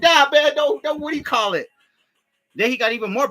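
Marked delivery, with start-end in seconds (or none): 0.0-1.7 high, loud, pleading voice; panicky plea. Retold or imitated. Inmates pleading with naked wrestlers to stop, high and loud. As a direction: beg them to stop, high-pitched. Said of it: "We were just like".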